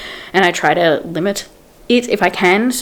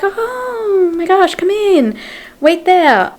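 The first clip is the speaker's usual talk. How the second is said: high, slow, fluttery welcoming lady voice; lady at the door; 11 semitones higher, much slower than usual. Retold or imitated. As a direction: play her as a high-pitched, overeager hostess.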